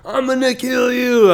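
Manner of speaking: high, loud, slow mock yell; retold yell. Opponent yelling a threat across the weigh-in room. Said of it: "he's yelling at me".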